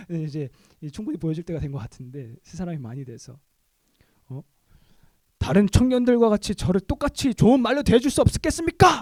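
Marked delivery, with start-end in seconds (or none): 5.4-9.0 loud, higher, defiant protest; indignant demand. Retold or imitated. Young man loudly confronting his pastor, indignant. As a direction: push back hard at the pastor.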